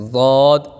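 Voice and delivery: ضاد said full and heavy, medium softness; full heavy dwad. Shown as a demonstration. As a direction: give ضاد a thick, middle sound. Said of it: "درمیانی آواز سے". (ضاد موٹا، درمیانی نرمی سے)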